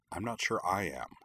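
soft, half to herself; under her breath. A narrator's reading. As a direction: mutter it under your breath. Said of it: "she added under her breath".

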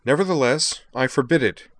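high, loud, stubborn refusal; insistent. A narrator's reading. Liza persisting in forbidding Sky, insistent. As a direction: put the foot down firmly. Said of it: "Liza persisted".

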